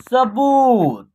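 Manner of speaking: सबूत with बू stretched out; long vowel. Shown as a demonstration. Teacher giving the whole word, सबूत with long ऊ. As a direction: hold the ऊ in सबूत. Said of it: "घींच करके".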